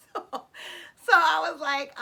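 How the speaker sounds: laughing through the words. Real, not acted. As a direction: chuckle while you say it. Laughing while retelling the cost.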